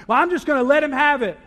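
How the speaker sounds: mock indignant. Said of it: "You're gonna".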